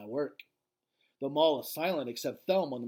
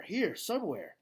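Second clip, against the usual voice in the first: ordinary delivery, nothing marked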